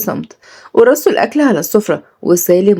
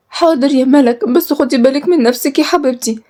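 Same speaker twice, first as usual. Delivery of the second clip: put-on higher, small girlish voice; meek younger sister; 6 semitones higher than usual. Girl tells her sister to take care, meek high voice. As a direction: answer in a high, worried little voice.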